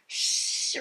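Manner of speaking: imitated mom's sharp shush. Mom to her yelling little girl, shushing. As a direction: hush a child with a long shh. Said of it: "So she starts shushing the little girl".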